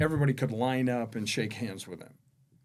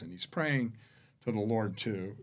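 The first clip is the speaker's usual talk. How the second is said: ordinary talk, nothing marked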